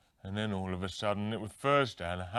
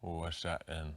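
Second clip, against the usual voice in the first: dopey mumbling Graham; 4 semitones lower, 3 dB quieter than usual. Pretend. Graham spoofed answering the interviewer, dim and mumbling. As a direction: sound lost and easily confused.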